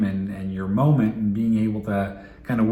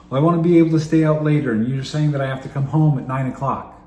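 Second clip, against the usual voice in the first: put-on fast, louder, higher teenage complaint; fast, louder indignant teen; exasperated teen; 3 semitones higher, 6 dB louder, faster than usual. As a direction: rattle off the grievance like a fed-up teen. Teen argues case.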